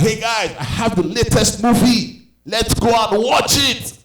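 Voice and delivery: high, eager, stretched-out call; rally the siblings with big excitement; gleeful, singsong invitation; excited kid announcement. Retold or imitated. Excitedly calls sisters to watch movie.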